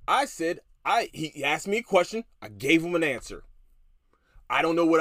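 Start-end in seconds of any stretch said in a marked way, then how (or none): none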